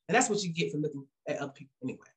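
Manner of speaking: ordinary talk, nothing marked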